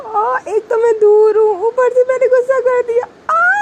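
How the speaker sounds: high, loud whiny wail ending in a sob-like cry; frustrated wail. Acted. Girl on scooter frets about snapping at girlfriend, loud whiny wail. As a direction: whine out loud in frustration, then break into a wail. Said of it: "उसी पे हल्का सा चिल्लाने लगती है".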